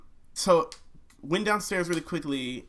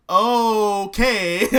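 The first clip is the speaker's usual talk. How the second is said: big, high, loud exclamation; delighted realisation; 7 semitones higher, 11 dB louder than usual. Retold or imitated. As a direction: stretch it out, thrilled with yourself. Retells delight at the new hook.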